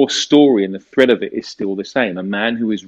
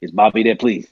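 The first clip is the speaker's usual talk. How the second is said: lightly put-on American accent; casual American caller asking politely. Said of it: "start talking in an American accent".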